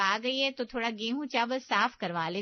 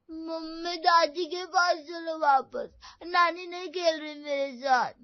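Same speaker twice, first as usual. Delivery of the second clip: put-on child's tearful whine, louder; whiny crying child; 6 semitones higher, 3 dB louder, slower than usual. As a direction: sulk and whimper to mom. Child whines to mom about nani. (रोती हुई बच्चे की शिकायत)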